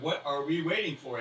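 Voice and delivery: itching to start eating; eager. Acted. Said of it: "he's already like".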